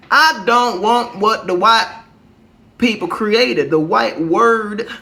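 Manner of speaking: emphatic lecturing. Real, not acted. Podcaster gives y'all a little history, emphatic lecturing.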